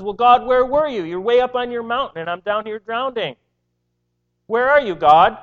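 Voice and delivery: frustrated believer shouting up at a distant God; anguished outcry. Retold or imitated. Psalmist blames God.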